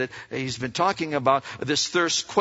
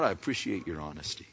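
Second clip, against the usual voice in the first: soft, gentle appreciation; quiet sincere appreciation; 10 semitones lower, 10 dB quieter than usual. Acted. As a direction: say it softly, kindly. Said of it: "He just says".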